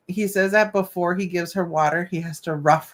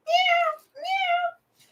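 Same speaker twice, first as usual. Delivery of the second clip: two much higher, thin imitated meows; mock cat meow. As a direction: beg like the cat. Imitating the cat's begging.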